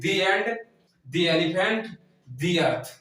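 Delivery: each "the" said "dee" before the vowel; long ee in "the", as in "dee elephant"; "the" as thee. Shown as a demonstration. Teacher listing vowel-word examples, each "the" said as "dee". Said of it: "उसको हम दी पढ़ते हैं".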